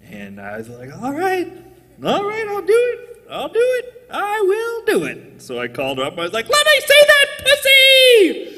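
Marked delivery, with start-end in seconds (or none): 0.9-5.4 high whiny giving in. Retold or imitated. Giving in, acted. 6.5-8.4 very high, loud, frantic yell; shrieking high-pitched raunchy shout; high screaming shout. Retold or imitated. Shouting lewd lines on phone.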